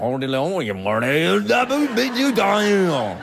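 a rough, laughing imitation of a New Orleans French-tinged accent; hammed-up New Orleans accent, slowing at the end; playful fake New Orleans French accent; mock New Orleans accent. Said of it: "that's how they speak".

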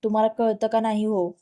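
plain reading voice

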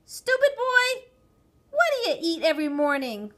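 high, loud exasperated mother; exasperated parental outburst; angry scolding. A narrator's reading. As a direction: berate the child loudly. Mother scolds child in story.

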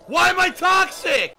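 slow, loud and high protest; indignant shout. Real, not acted. Streamer yelling back at a viewer's jab, indignant. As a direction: yell it in outrage.

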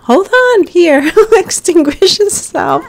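high, loud flustered command; urgent, half-laughing panic; frantic urging. Real, not acted. Player telling the burning sim to extinguish, frantic. Rushing to put out fire.

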